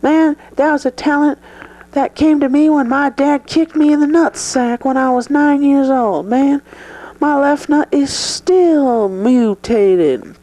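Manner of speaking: Joe pushes back, insists on speaking freely; defiant Joe. Acted. To interviewer, Joe defiantly demands to be real. Joe's high-voiced nutsack story.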